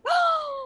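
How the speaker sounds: high, sharp shocked gasp. Retold or imitated. Mother overhearing her son cuss, shocked gasp. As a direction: gasp like you caught him red-handed. Mom catches son cussing.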